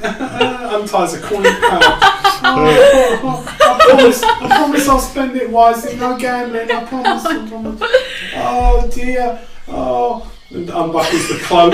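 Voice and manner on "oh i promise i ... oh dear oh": squeaky high I promise, half laughing; high pleading promise. Acted. The elf promises her dead mate to spend the coins wisely.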